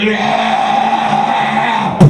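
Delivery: long, drawn-out yell with a changed voice; climactic groan. Acted. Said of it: "and groans".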